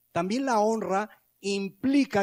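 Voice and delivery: ordinary talk, nothing marked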